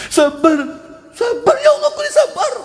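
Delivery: high, whiny cry of sabar, sabar; mock whining. A grieving believer insists on sabar, hammed-up high whine. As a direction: whine sabar like a grumbler pretending to be patient.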